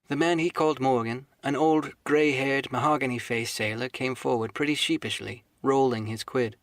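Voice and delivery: plain reading voice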